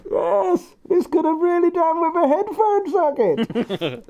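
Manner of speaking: put-on high, loud Arnie-style German accent. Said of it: "whenever I do a German voice".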